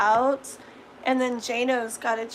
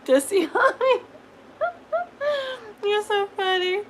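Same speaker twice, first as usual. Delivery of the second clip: laughing at own joke; 9 semitones higher, 4 dB louder than usual. Real, not acted.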